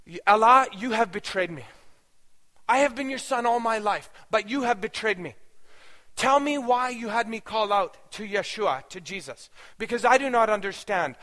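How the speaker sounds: high, loud accusation of betrayal; anguished diatribe. Retold or imitated. Aishin accuses Allah of betraying him, in a loud anguished rant. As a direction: pour out hurt and fury at God. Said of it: "continued this diatribe".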